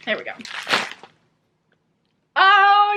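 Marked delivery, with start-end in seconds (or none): 2.4-2.9 high, loud "Oh"; thrilled surprise; delighted gasp. Real, not acted. Opening the last package.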